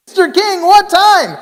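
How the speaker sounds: high frantic plea; desperate begging. Retold or imitated. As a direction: beg through the windshield.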